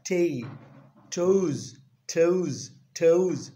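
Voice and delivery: "tay", "toes" barked out loud, one by one; loud drilled syllables. Shown as a demonstration. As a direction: hammer each syllable like a drill. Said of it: "not ta".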